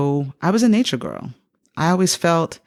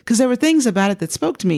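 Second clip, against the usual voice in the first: ordinary talk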